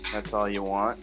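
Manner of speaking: ordinary talk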